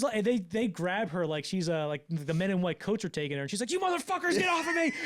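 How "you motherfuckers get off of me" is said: very high, loud struggling shriek; mock frantic scream. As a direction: imitate a woman fighting off those grabbing her. Acts woman being dragged away.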